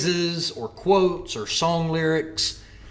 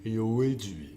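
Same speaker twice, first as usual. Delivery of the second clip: Stallone impression. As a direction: talk like a tired palooka. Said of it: "my Sylvester combines with my Robert De Niro".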